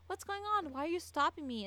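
acting out a bewildered teenager; confused questioning. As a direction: voice a puzzled driver demanding answers. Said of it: "Stephen is asking".

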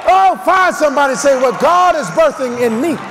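forceful, rhythmic sermon delivery; full-force preaching. Real, not acted. Preacher urging the church to magnify the Lord, full-force preaching. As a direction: drive each phrase out to the whole church.

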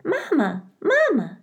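very high tiny peeps; a newborn chick calling for mama; peeping gosling. A narrator's reading. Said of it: "peeped the gosling".

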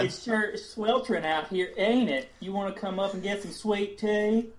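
high Southern belle hostess; Southern lady voice. Acted. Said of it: "role play Carolyn".

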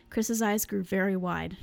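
ordinary delivery, nothing marked

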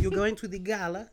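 high, bright Eastern European accent imitation; Lithuanian accent. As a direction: greet a coworker in a put-on Lithuanian accent. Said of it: "She's like".

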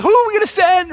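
loud, high-pitched mock deliberation; calling out. The holy huddle wonders whom to send, loud high call. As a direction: throw the question out loudly.

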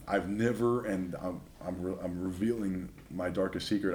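usual speaking voice

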